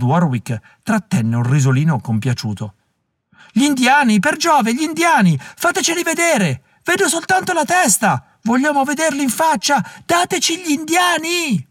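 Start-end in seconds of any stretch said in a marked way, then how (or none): 3.5-5.4 high excited shouting; rowdy crowd cry. A narrator's reading. Theatre crowd demanding the Indians, high excited cry. 5.4-11.7 very high, strained heckler voice; rowdy crowd voice. A narrator's reading. Mob heckling the actors on stage, high strained mob voice.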